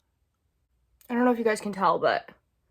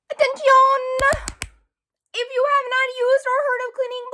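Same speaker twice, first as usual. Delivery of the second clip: very high, drawn-out monotone proclamation; mock announcer; 10 semitones higher than usual. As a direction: hold every phrase high like a silly town crier. Mock announcement for a lip product.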